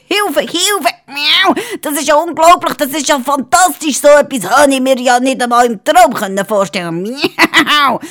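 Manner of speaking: high, hammed-up fake panic; mock fright. The cat fakes fear before the wizard-lion, high and hammed-up. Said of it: "hat so getan, wie wenn er total erklüpft wäre".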